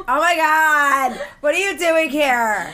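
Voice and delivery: drawn-out, campy mock gasp; imitating a gushing, flamboyant stranger; flamboyant gushing man. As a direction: stretch every word like a flamboyant party guy. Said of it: "he's wearing a tutu and he goes".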